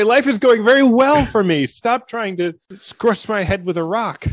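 a put-upon deer scolding the drivers; mock indignant deer.

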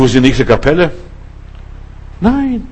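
normal talking voice

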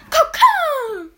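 high loud cawing cry; crow caw. Retold or imitated. Imitating the Crow voice line.

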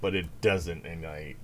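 normal talking voice

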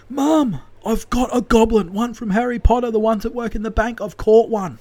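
high, loud excited imitation; thrilled caller, insisting on the catch; excited son. Joe's second excited call.

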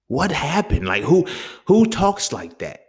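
high, loud, slow disbelieving question; incredulous. Real, not acted. Venting to viewers about scared men, loud incredulous disbelief. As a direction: ask it in utter disbelief.

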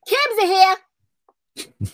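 high, loud, aggressive holler; aggressive Roseanne Barr. Acted. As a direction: announce it in an aggressive put-on voice. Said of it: "sound like an aggressive Roseanne Barr".

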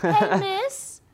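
high, loud child voice, put on; kid calling teacher. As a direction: pipe up like a pupil. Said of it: "expecting the kids to be like".

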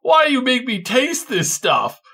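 high, loud, slow whiny yelling; disgusted talking sword. Retold or imitated. Talking sword complaining after killing something slimy, loud high whine. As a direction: squeal in disgust like a spoiled blade. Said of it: "you killed something gross or slimy, it was like".

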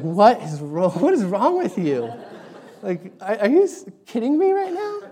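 loud, high mock outrage, climbing at the end; exasperated wife. Wife scolding husband for screaming and making the baby cry, mock exasperated. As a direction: scold in disbelief, imitating an appalled wife. Acting out wife's angry reaction.

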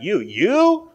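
loud, high stunned repetition; can't believe it's you; emphatic disbelief. Retold or imitated. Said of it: "he is emphatic about that".

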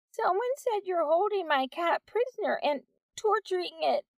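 put-on high, scared child voice; a frightened kid blurting it out; terrified boy. Ten-year-old boy facing Missus Wack, terrified.

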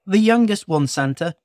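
put-on high little old elf voice; eager little elf; christmas elf. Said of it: "said the elf".

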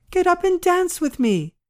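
put-on very high, playful young widow's voice; merry cry. A narrator's reading. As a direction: urge him gleefully to join in. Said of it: "cried she".

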